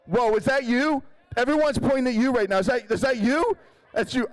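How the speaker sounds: spotting someone pointed at by the crowd, excited; teasing call-out. Real, not acted. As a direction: call them out with delight. Teasing an audience member.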